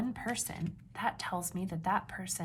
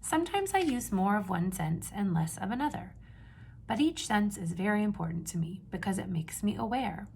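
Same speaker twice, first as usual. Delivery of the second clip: put-on reading to children. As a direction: read each page out to the class with energy. Teacher reads picture book aloud.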